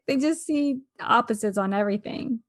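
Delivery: ordinary talk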